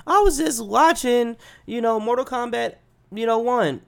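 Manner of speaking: high-pitched, comic bewildered whine; baffled protest. Retold or imitated. Waking up mid-video at night, baffled protest.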